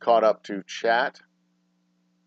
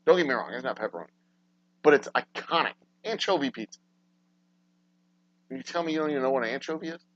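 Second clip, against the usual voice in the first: ordinary talk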